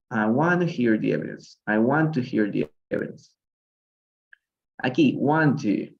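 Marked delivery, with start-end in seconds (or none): none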